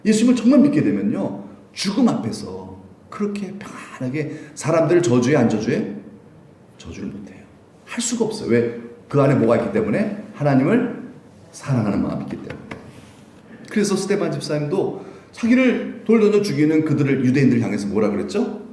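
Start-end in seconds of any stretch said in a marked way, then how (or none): none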